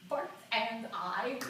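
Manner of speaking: slow, stretched-out musing at normal pitch; drawn-out, dreamy repeat; dreamy savoring. Acted. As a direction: linger over each word, coming down from the thrill.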